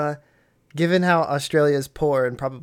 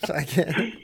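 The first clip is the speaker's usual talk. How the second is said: ordinary talk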